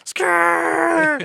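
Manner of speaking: high, loud drawn-out skid noise; tire screech imitation. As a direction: screech like spinning tires. Imitates tires in the donuts.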